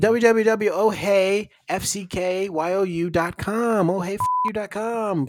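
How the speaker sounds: spelled-out URL, announcer style; ad read. Acted. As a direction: announce the web address. Reading sponsor web address.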